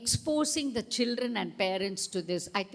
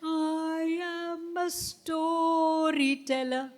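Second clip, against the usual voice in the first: higher, very slow singsong; high storyteller voice; 6 semitones higher, much slower than usual. Shown as a demonstration. Teacher showing the class a high, slow storyteller voice. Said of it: "Which voice?".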